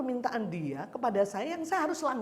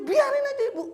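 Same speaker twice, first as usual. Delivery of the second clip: high, loud mock brush-off; dismissive advice; 7 dB louder, faster than usual. Colleagues urging Bu Yus to ignore her, high mock dismissal. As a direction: wave it off, let her be.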